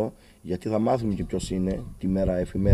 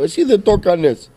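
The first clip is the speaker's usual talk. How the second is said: much higher, much louder furious yell; furious shout; 13 semitones higher, 8 dB louder than usual. Retold or imitated. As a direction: yell at the doctor in outrage. Father shouts at the doctor. (έξαλλη φωνή στον γιατρό)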